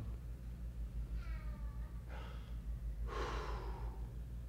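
long breathy sigh; weary sigh. Real, not acted. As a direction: let out a long, stressed sigh. Sighing over stressful news.

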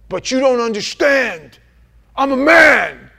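high, loud, offended outcry; mimicking a proud man insisting he matters; indignant protest. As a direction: puff up like a wounded ego. Mocking a proud man's protest.

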